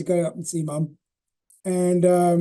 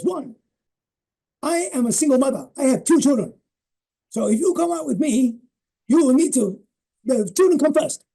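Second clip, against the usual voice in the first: higher voice for mum, firm; imitating mum; 8 semitones higher, 4 dB louder than usual. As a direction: play mum spelling out her terms. Mum voiced stating her conditions.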